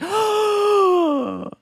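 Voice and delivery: sharp high mock gasp of joy; retold delighted gasp. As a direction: gasp like a happy message just arrived. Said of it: "I'd be like".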